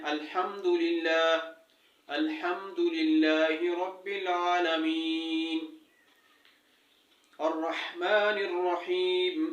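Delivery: slow, drawn-out tajweed recitation; Quran recitation. Shown as a demonstration. Teacher reciting Fatiha to students, slow and measured. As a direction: recite each word slowly and carefully.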